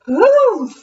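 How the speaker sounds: high, loud huff; scoffing ghost. Acted. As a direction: huff in disdain.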